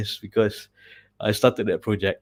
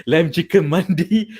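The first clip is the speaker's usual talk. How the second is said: ordinary delivery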